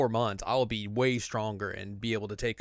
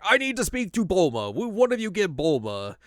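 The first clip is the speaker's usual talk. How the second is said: higher, formal alien voice; Jocko stiff; 9 semitones higher, 5 dB louder than usual. Retold or imitated. Jocko demanding Bulma from the kids, stiff. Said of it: "And Jocko's like".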